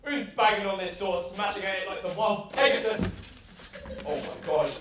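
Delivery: usual speaking voice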